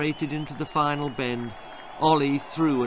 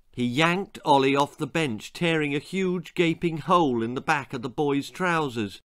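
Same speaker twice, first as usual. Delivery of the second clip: ordinary delivery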